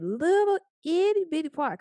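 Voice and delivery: cutesy coaxing. Shown as a demonstration. Coaxing tender phrase.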